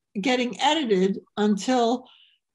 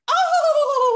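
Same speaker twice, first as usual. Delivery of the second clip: much higher, louder trilled oh; 7 dB louder than usual. Retold or imitated. Comedian shows her trademark voice trill, high and loud. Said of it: "I'm like".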